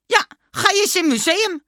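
loud, high emphatic yes; excited Dummy. A narrator's reading. Dummy confirming his museum find to the teacher, excited and loud. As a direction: confirm it at full pitch, loudly.

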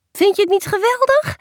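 loud, high-pitched burst of delight; excited outburst. Acted. Parent interrupting the gift letter, excited outburst.

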